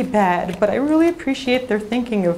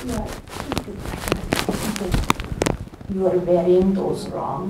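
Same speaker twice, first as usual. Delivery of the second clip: put-on secretive whispered old grandma; 7 semitones lower, 6 dB quieter, much slower than usual. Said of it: "my grandmother comes over to me".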